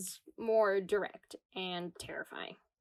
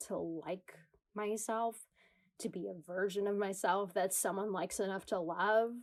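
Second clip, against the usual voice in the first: normal talking voice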